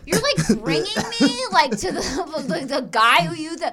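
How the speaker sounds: high, loud, laughing ew of disgust; disgusted teen. Retold or imitated. Imitating her teen self to her mom, laughing disgust. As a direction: squeal it out in horrified giggles. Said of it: "And I'm like".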